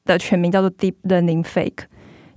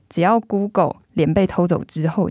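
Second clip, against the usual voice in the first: ordinary talk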